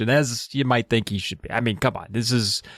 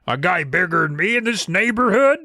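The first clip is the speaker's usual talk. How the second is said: shocked bully; 6 semitones higher than usual. Retold or imitated. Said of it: "He's like".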